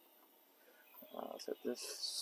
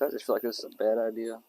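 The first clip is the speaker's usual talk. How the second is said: ordinary talk, nothing marked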